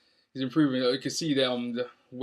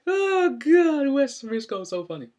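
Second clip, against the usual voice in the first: amused outburst; 13 semitones higher, 9 dB louder than usual. Real, not acted. Laughing at Briscoe's line.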